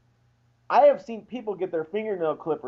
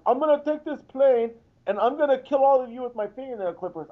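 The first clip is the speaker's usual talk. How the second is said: put-on higher-pitched hijacker in a muddled, vaguely Irish accent; unidentifiable mock accent; 7 semitones higher than usual. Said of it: "I don't know what accent that was".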